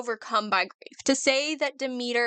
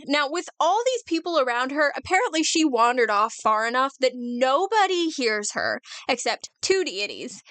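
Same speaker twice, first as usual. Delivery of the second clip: ordinary talk, nothing marked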